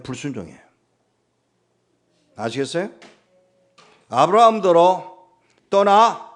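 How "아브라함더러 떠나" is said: God's command. Acted. God commands Abraham to leave.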